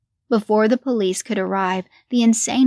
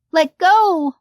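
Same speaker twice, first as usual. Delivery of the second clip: high, loud scream at her mother; 9 semitones higher, 6 dB louder than usual. A narrator's reading. Daughter screaming at mother to let go. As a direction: yell it, pulling free. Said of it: "Lily screamed".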